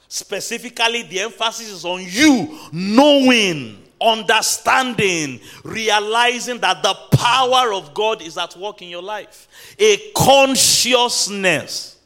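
loud, high, stretched key words; impassioned, slow-rolling sermon shout; full-force preaching. Real, not acted. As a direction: hammer each word out to the congregation.

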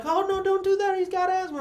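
high-pitched imitation of begging; mock pleading. Man begging bullies to stop the beating, high plea. Dude pleads again for partner.

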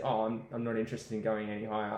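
usual speaking voice

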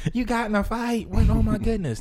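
high, startled question from mom; shocked mom. Retold or imitated. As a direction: gasp it out like a worried mother.

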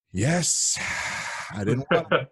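high, loud, reluctant whine; reluctant whiny answer. Retold or imitated. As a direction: whine it like you're forced. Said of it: "they're all like".

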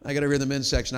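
normal talking voice

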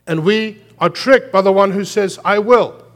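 loud, high preacher's cry; preaching at full force. Acted. Pastor pressing the point on the church, loud preaching. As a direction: drive it home from the pulpit.

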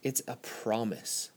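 slow, quiet stress on promise; preacher lets promise land softly; emphatic soft close. Acted. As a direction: drop soft and lean on promise. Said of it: "not just a statement".

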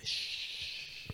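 whispered deadpan punchline. Pretend.

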